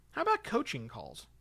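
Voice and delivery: ordinary talk, nothing marked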